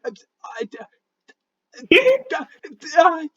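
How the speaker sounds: high-pitched, panicky stutter; stuck on the first word, voice shooting up; squeaky, embarrassed sputtering; flustered stammering. Acted. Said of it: "his face is, like, bright green".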